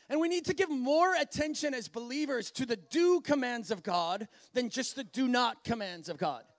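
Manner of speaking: ordinary talk, nothing marked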